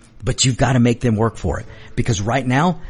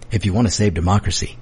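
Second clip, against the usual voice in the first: ordinary talk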